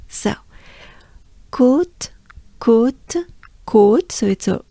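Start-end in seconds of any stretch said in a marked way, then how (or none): 1.5-4.1 côte with a closed O, higher; French côte. Shown as a demonstration. Teacher breaking down Côte de Trépaille. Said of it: "let's break this down".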